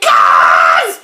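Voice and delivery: very loud, long outraged scream. A narrator's reading. Grandma throwing George's word back, screaming. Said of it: "she screamed".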